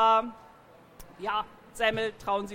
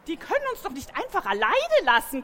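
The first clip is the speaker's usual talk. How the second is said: higher, squeaky voice of a frightened mare; horrified squeak; 6 semitones higher, 3 dB louder than usual. A narrator's reading. The pregnant mare protesting being left alone, squeaky and horrified. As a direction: squeak it out in horror. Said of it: "quietschte die Gräfin entsetzt".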